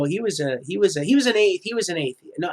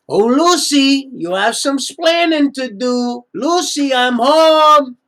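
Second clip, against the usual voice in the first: put-on higher, louder Cuban-accented sitcom voice, slow; Cuban sitcom husband; 7 semitones higher, 7 dB louder, slower than usual. Husband scolding Lucy on coming home, in a hammed-up Cuban accent. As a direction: ham up the Cuban accent of a sitcom husband calling his wife. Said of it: "I didn't even realize when I was saying that".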